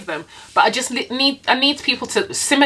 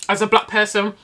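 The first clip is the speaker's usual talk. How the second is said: normal talking voice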